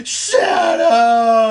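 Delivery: high, loud anguished film cry; imitated desperate movie shout; Michael J. Fox. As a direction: bellow for the dog, hammed-up and tearful. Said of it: "It's literally Michael J. Fox's performance".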